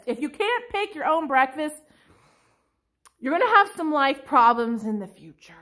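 normal talking voice

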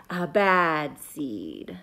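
slow, low, ominous reading; slow ominous storybook reveal; dramatic reveal. A narrator's reading. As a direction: drop it in slowly like a dark secret.

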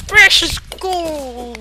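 very slow, high greedy miner; miner shouting in tunnel. Acted. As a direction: savor each word like a gold-mad miner. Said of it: "I don't have that miner, uh, voice".

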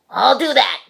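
loud, sharp retort; screeched. Acted. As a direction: spit it back loud and sharp. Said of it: "screeched the grouchy ladybug".

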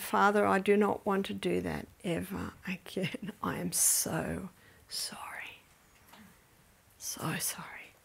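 fading into a hushed, broken whisper; contrite prayer. Retold or imitated. Mother confessing to God in prayer, fading to a sorry whisper. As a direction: pray sorrowfully, dropping to a whisper. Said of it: "And I said".

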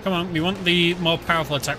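ordinary talk, nothing marked

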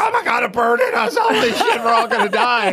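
high panicked shout, laughing; panicked screaming. Retold or imitated. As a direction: scream it like the plane is going down.

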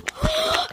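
high, loud gasp of delight; real thrilled gasp; sharp gasp. Real, not acted. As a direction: gasp like you can't believe it. Sees her dream toy.

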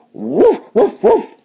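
high, yappy woofs; excited small-dog barks; dog barking. Acted. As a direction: bark out a string of high woofs. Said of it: "the dog called the cat".

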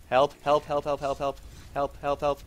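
quick, urgent repeated cries; frantic calls for help. Real, not acted. Player fleeing enemies, calling for help in panic. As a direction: call out like you're being chased.

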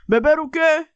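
high, puzzled cartoon dog; squeaky confused pup.

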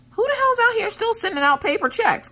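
high, outraged question; baffled by a paper check; indignant surprise. Retold or imitated. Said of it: "and I'm like".

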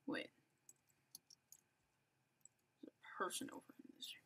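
hushed whisper while sneaking up on players; sneaky whisper. Real, not acted. Gamer whispering while creeping toward an enemy, hushed. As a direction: breathe the words so nobody nearby hears.

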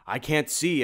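high, loud frantic wail; a blinded monster crying out; high loud panic; panicked cry. Acted. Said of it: "it cried".

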